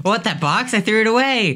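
high, casual mock mom. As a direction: answer like a dismissive mom. Mom voice in skit.

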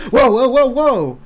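high, loud startled whoas, backing away; alarmed whoa. Acted. Prisoner handed a knife by Beth, loud alarmed whoa. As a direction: yelp whoa as if a knife is shoved at you.

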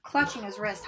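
ordinary delivery, nothing marked

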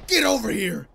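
high, strained cry; frustrated yell. Real, not acted. Player at the game after losing, yelling. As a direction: yell it on the tail of a scream.

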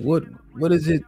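ordinary talk

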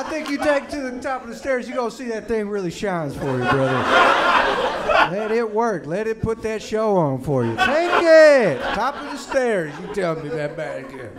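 loud, pushy father hammering it home; insistent dad. Retold or imitated. Dad loudly insisting to his son the Slinky shines. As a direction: keep selling it, loud and worked up.

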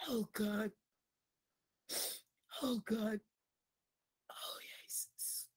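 very soft, low sigh to God; murmured, breath-heavy prayer; hushed prayer. Real, not acted. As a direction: sink into a whisper. Overcome in prayer, hushed calls.

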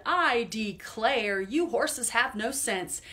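loud, slow scolding shout; farmer shouting. Acted. Farmer scolding his horses, slow loud shout. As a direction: bellow it like a fed-up farmer. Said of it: "Farmer shouted".

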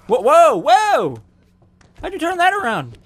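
high, loud, stretched whoa; stunned shouting. Real, not acted. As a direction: yell in total disbelief. Shocked at fight turn.

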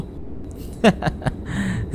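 soft run of ha-ha laughter; scornful laugh. Acted. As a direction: laugh at a frightened friend. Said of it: "একটা হাসি দিয়ে বলে উঠল".

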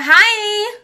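bright high, loud little hi; high-pitched hi. Retold or imitated. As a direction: squeak out a flirty hi.

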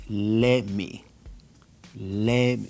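"let me" dicho como "lemi"; T perdida. Shown as a demonstration. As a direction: omite la T de "let me". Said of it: "se pierde la T". ("let me" sin T, lento)

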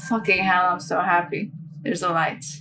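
usual speaking voice

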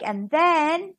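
ordinary delivery, nothing marked

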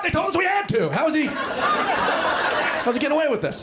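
high mock-indignant dad; jealous dad. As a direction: play the outraged, jealous father. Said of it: "He's like".

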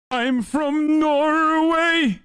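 high, drawn-out singsong Norwegian accent; very slow, high-pitched mock Norwegian. Shown as a demonstration. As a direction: belt out a cartoon Norwegian accent. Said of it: "this is how they sound when they're actually from Norway".

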